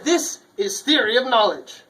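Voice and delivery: loud, high title announcement; announcer voice. Acted. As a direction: proclaim the title.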